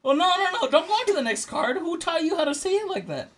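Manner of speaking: high-pitched, scandalized protest; incredulous objection. Real, not acted. Friend refusing next card over pronunciation, incredulous. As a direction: stop everything in disbelief.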